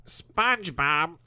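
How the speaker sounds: slow, bored Squidward drawl through a pinched nose; Squidward impression. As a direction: sound utterly unenthused like Squidward. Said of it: "that's a really good Squidward impression".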